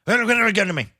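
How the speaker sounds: loud, high, garbled mock Irish accent; unintelligible Irishman. Old Irishman answering the whiskey question, in a garbled Irish accent. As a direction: slur it into incomprehensible Irish. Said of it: "you got to tell me what this man is saying".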